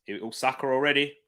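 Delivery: ordinary delivery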